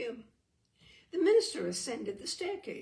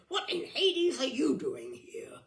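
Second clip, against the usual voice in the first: angry demand; 4 semitones higher, 7 dB louder than usual. A narrator's reading. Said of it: "Robert demanded".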